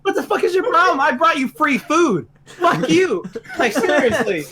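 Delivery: high laughing outburst; laughing rant. Real, not acted. Worker venting at pizza-hating coworkers, laughing. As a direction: rant at them while laughing.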